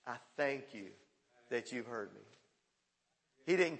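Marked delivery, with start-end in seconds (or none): 0.1-2.2 soft, low prayer of thanks; hushed prayer. Retold or imitated. Preacher voicing Jesus praying to his Father, soft and low. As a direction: pray it softly, low and certain.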